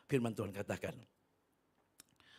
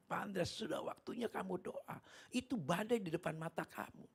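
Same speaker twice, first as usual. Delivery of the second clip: retold captain's plea; 8 semitones higher than usual. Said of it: "kapten kapal sampai bilang".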